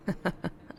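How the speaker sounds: soft unvoiced laugh through the nose; a short airless chuckle; breathy chuckle. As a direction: huff a quiet dark laugh. Jokes about crosses and monsters.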